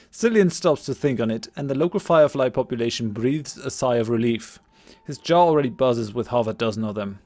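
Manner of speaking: ordinary talk, nothing marked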